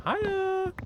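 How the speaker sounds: a tiny chirpy squeak of a hello; squeaky high greeting. Pretend. Streamer greeting a viewer in chat, squeaky high hello. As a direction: squeak it out like a cartoon critter.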